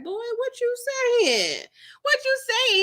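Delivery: high, sassy what you saying; sassy comeback. Retold or imitated. Roulette brushing Duffy off, sassy comeback. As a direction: snap it back with attitude.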